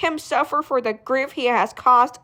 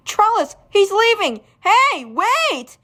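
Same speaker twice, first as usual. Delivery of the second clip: calling out; 8 semitones higher, 4 dB louder than usual. Acted. Character runs off, calling after.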